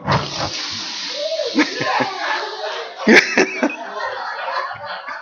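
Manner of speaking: high, breathless laughing release; prolonged high-pitched giggling fit; helpless laughter. Acted. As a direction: let go into long gasping laughter. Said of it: "it felt like this".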